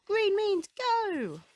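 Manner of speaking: high, loud, slow barked cry; barked catchphrase. A narrator's reading. Rocky shouting his catchphrase to the team, high and loud. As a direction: bark it out proudly. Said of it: "barks Rocky".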